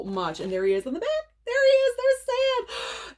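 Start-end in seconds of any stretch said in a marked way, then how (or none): 1.5-3.1 very high delighted squeal; high excited squeal. Real, not acted. Finding the actor on the back cover, squealing. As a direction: squeal like you spotted a crush.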